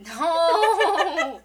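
loud laughing no; laughing shout. Real, not acted. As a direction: yelp it out through a laugh. Laughing embarrassed outburst.

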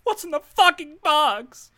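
slow, high, loud anguished yell; anguished movie yell. Pretend. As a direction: scream it like the film's climax. Acting the Seven movie scene.

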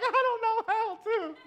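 hammed-up helpless whine; mock helpless. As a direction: plead it like a lost kid, in jest. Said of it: "And I'm like".

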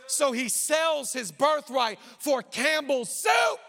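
loud, driving sermon delivery; full-force preaching. Acted. Pastor preaching to the church, full force. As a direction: preach it hard to the congregation.

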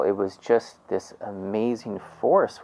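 normal talking voice

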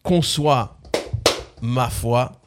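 spoken verse slowing and sinking, with pauses; lyric recitation. Acted. Quiz host rereading clue lyrics, slowing recitation.

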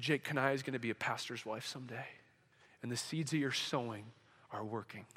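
low, very soft, near-murmured message; soft, low and reverent; hushed revelation. Retold or imitated. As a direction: say it quietly, like a private word from God. Retelling the Holy Spirit's words in prayer.

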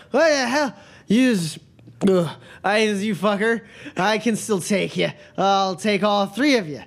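put-on high, slurred drunken bluster; drunk brawler. Fallen drunk defying three opponents, high and slurred bluster. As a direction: sputter in shock, then boast drunkenly. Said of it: "brandishing it drunkenly".

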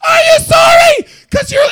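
piercingly high, very loud squeal; cartoon-mouse rage yell. Answering the phone apology, furious cartoon-mouse yell. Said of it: "like I was a cartoon mouse angry".

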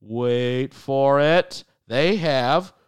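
slow teasing wait for it; drawn-out suspense build. Acted. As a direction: stretch out the suspense. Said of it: "There's a lot of waiting".

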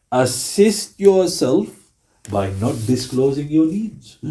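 slow, deliberate hadith quote; solemn recitation. Acted. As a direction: lay each word down slowly, with reverence. Repeating the hadith.

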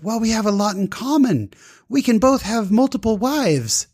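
high, exuberant teasing; Abdulia. Retold or imitated. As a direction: deliver the joke in a high, gleeful voice. Driver's exuberant joke, acted out.

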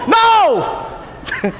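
ordinary delivery

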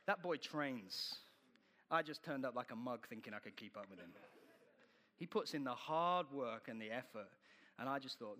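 ordinary talk, nothing marked

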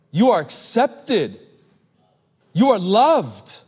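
slowed, each word weighted; slow assurance. Acted. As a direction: reassure them one phrase at a time. Preacher assuring congregation slowly.

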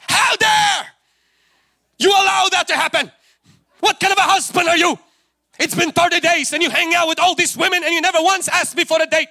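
loud, high-pitched furious tirade; tantrum. Acted. Queen berates the king, her husband, in a screaming tantrum. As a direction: shriek it like a hammed-up wronged wife. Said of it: "give him a tantrum".

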